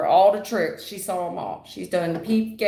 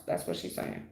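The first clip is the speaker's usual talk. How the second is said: soft unvoiced whisper; confiding whisper to the viewer; whispered aside; 11 dB quieter than usual. Real, not acted. Aside to client about mother.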